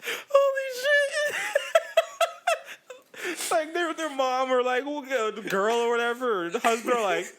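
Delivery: high voice, laughing through it; giggly put-on voice, sliding back down; laughing mock voice. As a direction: play the flustered character, barely holding it together.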